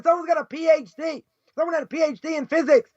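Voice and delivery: normal talking voice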